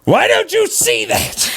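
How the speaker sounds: high frustrated cry; exasperated shout. Real, not acted. Urging the particle to let go, high frustrated shouting. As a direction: yell it in disbelief. Pleading with stubborn listeners.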